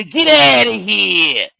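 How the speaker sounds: slow, stretched-out loud holler; jeering shout. Real, not acted. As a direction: holler them off the property, drawing out each word. Jeering shout at people in clip.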